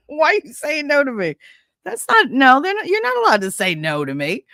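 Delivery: affronted, starting high and settling; mock indignant parent. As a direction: scold in playful disbelief. Indignant parent scolding her child.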